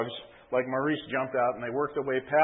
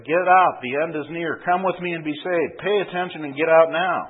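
louder urgent shouting; calling out; 4 semitones higher, 7 dB louder than usual. Acted. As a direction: cry out the warning, louder. Protester cries out again.

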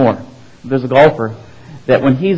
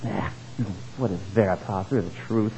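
lower, soft sneering Latin question; mocking; 5 semitones lower, 12 dB quieter than usual. Pilate scornfully asking Jesus about truth, soft and low. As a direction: scoff at the very idea of truth. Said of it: "in his mocking voice".

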